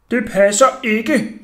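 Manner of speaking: high, loud, slow accusation; angry shout. A narrator's reading. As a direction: shout him down angrily, word by word. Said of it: "råbte jeg vredt".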